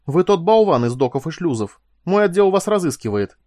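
loud, high indignant recognition; shocked and outraged; aghast accusation. A narrator's reading. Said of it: "ахнула она".

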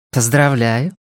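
lightly voiced woman, rising at the end; woman at bar. A narrator's reading. Woman at the bar congratulating a stranger, lightly voiced with rising tune. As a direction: congratulate him with a questioning lift, lightly in character. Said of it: "сказала какая-то женщина".

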